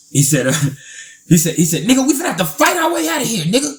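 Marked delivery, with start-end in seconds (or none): 1.8-3.7 fast, high-pitched imitated urging; urgent retelling. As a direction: rush it out high like a panicked friend. Retold friend urges a fight.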